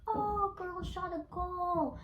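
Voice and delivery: high, drawn-out mock yell; mock scream, stretched and high-pitched; imitated screaming. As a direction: call it out high and slow, imitating jeering kids. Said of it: "they started screaming".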